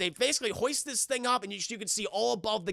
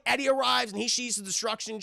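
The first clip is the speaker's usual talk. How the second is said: usual speaking voice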